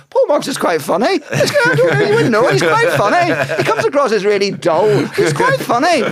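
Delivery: high, loud comic squawk; mimicking podcast chatter. Guest mocking podcast hosts judging a colleague, high hammed-up mimicry.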